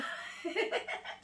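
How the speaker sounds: high breathy laugh; real giggle. Real, not acted. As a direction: burst into a short giggle. Laughs at the bad taste. (petit rire aigu)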